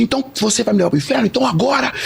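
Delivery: high, insistent re-enacted challenge; defiant declaration. Man retelling his challenge before hell, defiant.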